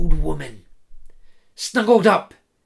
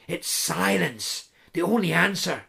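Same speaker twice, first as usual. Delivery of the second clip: plain reading voice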